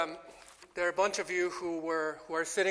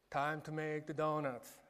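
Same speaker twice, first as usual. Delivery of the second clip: Dunkin' Donuts guy; 4 semitones lower, 7 dB quieter than usual. Retold or imitated. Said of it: "the Dunkin' Donuts, uh, guy".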